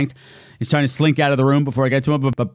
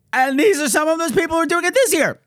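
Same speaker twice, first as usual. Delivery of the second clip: hyped up; 13 semitones higher than usual. Real, not acted. Hyped-up donor thank-you intro.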